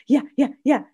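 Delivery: put-on high, eager toddler voice; high little-kid chirps, one after another; two-year-old toddler. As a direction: imitate a toddler's loud, high yeses. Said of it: "And she goes".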